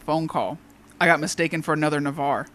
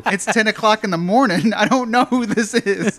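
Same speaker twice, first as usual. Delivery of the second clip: higher, louder protest, slowing at the end; mock exasperation; 5 semitones higher, 5 dB louder than usual. Pharmacy worker to unknown morning caller, mock exasperated. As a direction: drag out the last protest in bafflement. Baffled reply to caller, retold.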